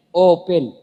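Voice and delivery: put-on the old man sounding out Open letter by letter; spelled out. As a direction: Open read out as if it were Indonesian. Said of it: "Dia eja itu".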